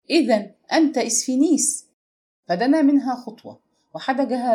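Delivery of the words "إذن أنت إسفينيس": higher-pitched exclaimed question; crying out. A narrator's reading. Princess to the man on hearing his name, crying out. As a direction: cry it out in a raised pitch, as a narrator would. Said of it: "وصاحت به".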